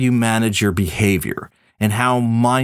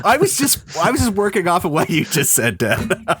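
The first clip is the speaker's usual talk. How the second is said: laughing; 6 semitones higher than usual. Real, not acted. Laughing while answering.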